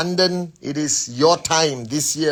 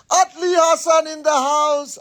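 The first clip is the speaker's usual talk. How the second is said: high, loud hype-man call; hyped announcement; 12 semitones higher, 3 dB louder than usual. Real, not acted. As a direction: announce them like an MC. Hype shout-out to a viewer.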